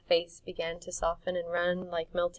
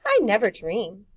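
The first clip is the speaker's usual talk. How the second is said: defiant; 2 semitones higher, 12 dB louder than usual. Acted. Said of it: "she said defiantly".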